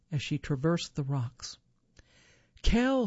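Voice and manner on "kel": louder, higher call of the name; hollered call. A narrator's reading. Wife calls for her husband after a landslide, restrained holler. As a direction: holler for someone out of sight. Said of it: "she hollered".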